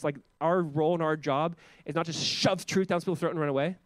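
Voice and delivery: usual speaking voice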